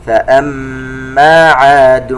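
slow, melodic tajweed chant; measured Quranic recitation. Shown as a demonstration. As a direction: recite slowly with held nasal sounds. Recites verse segment.

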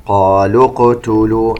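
"قالوا اقتلوا" joined, the final long ū of "قالوا" dropped into "اقتلوا"; dropped long vowel. Shown as a demonstration. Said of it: "المد الذي في نهاية كلمة قالوا سقط". ("قالوا اقتلوا" بحذف مد الواو عند الوصل)

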